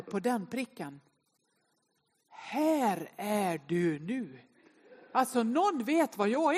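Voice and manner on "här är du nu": each word stretched out, lower in pitch; slow proclamation. Retold or imitated. Map's red dot telling the lost walker, slow solemn announcement.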